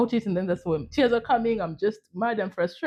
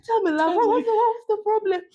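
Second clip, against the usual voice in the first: high, gentle motherly asking; landlady voice; 13 semitones higher than usual. Retold or imitated. Landlady soothing her tenant over tea. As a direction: coax the story out like a kind landlady. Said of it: "she went and put a kettle".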